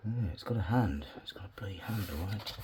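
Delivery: hushed whisper of quiet satisfaction; admiring the tree up close, whispered. Real, not acted. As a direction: whisper it to yourself, pleased. Quietly admiring his wiring result.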